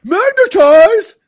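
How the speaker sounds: high, slow, stretched mock chant of "Magnetize"; drawn-out whiny chant of "Magnetize, magnetize"; mocking fans. Mocking fans who say "Magnetize", in a high, slow, stretched chant. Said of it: "when people are like".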